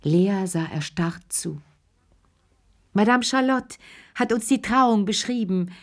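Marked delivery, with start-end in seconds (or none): 2.9-5.7 put-on high, bleating old lady's voice. A narrator's reading. Madame Aldonza reporting the wedding to the ladies, bleating. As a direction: bleat it out, pitched well up. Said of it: "blökte Madame Aldonza".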